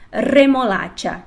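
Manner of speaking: Remolacha slow with a strong rolled R; trilled R. Shown as a demonstration. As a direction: roll the R in Remolacha, slowly. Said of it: "Primeiro o R forte".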